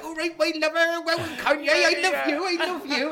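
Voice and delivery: high, eager gushing; gushing fan. Retold or imitated. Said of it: "[name]'s like".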